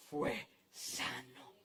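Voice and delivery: very soft, low and slow; drawn-out, breath-soft ending; hushed awe. Real, not acted. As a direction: let the healing land in a near whisper. Hushed outcome of the healing.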